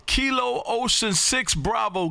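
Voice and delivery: ordinary delivery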